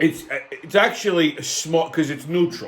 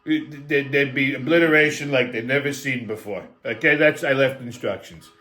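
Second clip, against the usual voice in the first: Trump impression; 6 semitones lower than usual. Comic telling a crowd about Trump's Gaza plan, in a low Trump voice. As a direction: slide down into a Trump drone. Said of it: "I said".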